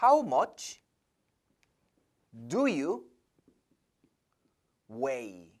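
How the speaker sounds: slow, spaced-out model sentence; teacher spelling out the better phrasing to students, slow and clear; model answer. Shown as a demonstration. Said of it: "동사로 써주는 게 더 좋습니다".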